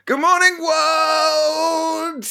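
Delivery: very high, stretched good morning; sing-song greeting. Acted. Rogue greets the morning at the window, very high and bright. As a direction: greet the whole world brightly.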